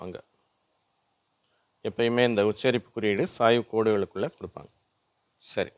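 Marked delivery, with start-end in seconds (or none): none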